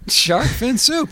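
high, drawn-out mocking repeat; stretched questioning parroting; incredulous echo. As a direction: echo it slowly and high, in disbelief. Mockingly echoes the translation.

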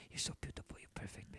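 soft breathy whisper, coaxing. Shown as a demonstration. Man demonstrating to friends what to whisper to her. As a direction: whisper it right into her ear, soothing. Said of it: "always whisper in her ear".

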